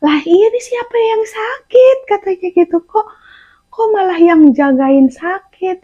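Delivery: high, puzzled nurse; confused nurse. Retold or imitated. Nurse asking who the patient is, mildly puzzled. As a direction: sound baffled at who is sick. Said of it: "perawatnya bingung".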